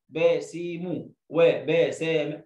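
slow باسم and وبسام broken into separate drawn-out syllables; wrong-way demo, dragging each syllable of باسم، وبسام; chopped wrong reading. Shown as a demonstration. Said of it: "دي مش قراءة". (تقطيع باسم وبسام ببطء)

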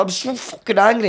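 high, strained fury; retold anger. Confessing anger to the translator, high strained outburst. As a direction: force it out through the anger. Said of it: "I'd always repressed my anger".

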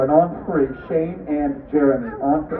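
ordinary talk

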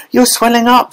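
very high panicked cry; screaming again. A narrator's reading. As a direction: repeat the alarm to everyone. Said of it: "screamed Missus Beauregard again".

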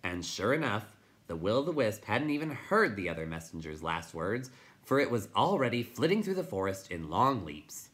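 plain reading voice

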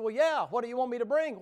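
high and loud, eager question; eager Cain. Acted. Cain asking the Lord what to bring, loud and high. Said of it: "He said".

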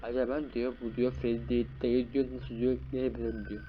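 usual speaking voice

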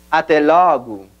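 ATÉ LOGO as "ah-TEH LAW-goo"; Portuguese pronunciation. Shown as a demonstration. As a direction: open é and first o in ATÉ LOGO, final u. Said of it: "vamos para el backtracking entonces".

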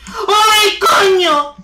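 anguished shout. Real, not acted. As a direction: cry out in alarm. Friend caught, cry out.

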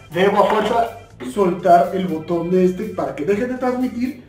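normal talking voice

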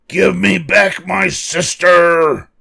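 high, loud, drawn-out roar; furious roar. A narrator's reading. Lucy demanding her sister from monsters, slow high roar. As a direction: roar each word slowly and loudly. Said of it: "Lucy roared".

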